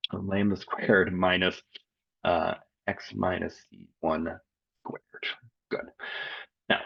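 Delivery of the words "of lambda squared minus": ordinary talk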